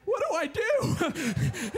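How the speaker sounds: high, slow, laughing panic; nervous laughing. Retold or imitated. Nervous tourist asking the guide what to do, laughing high. Nervous before jumping in cage.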